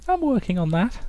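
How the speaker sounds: high-pitched put-on voice; artist character. Artist asked about her works, high falsetto. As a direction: excuse it in falsetto.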